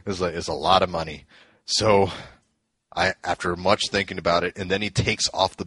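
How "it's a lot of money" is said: lightly imitated wrestler, low voice; a touch of low-pitched wrestler impression; Hulk Hogan. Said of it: "can't do the whole impression".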